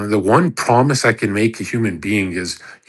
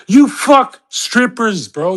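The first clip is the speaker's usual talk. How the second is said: much higher, louder, slow disbelieving call-out; incredulous retort; 9 semitones higher, 4 dB louder, slower than usual. Real, not acted. Calling out an influencer's hypocrisy, loud and incredulous. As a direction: hit it like you caught him lying.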